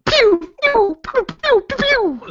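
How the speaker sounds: high pew pew laser noises; gunshot sounds. Pretend. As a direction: squeak out pew pews like a toy gun. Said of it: "I'm just gonna make gun sounds with my mouth".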